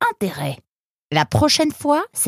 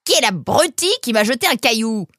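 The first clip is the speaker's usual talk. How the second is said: high, irate shout; angry demand; 8 semitones higher, 3 dB louder than usual. Acted. Fox hit by a pebble seeks the thrower, angry shout. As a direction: demand the culprit. Stone victim demands culprit.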